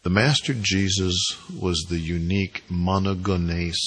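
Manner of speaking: usual speaking voice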